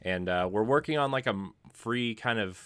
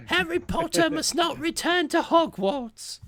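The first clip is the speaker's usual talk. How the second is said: much higher, squeaky put-on British elf voice; terrible British accent; 3 dB louder than usual. Host jokingly showing his bad British accent, squeaky. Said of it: "that's enough of my terrible accents".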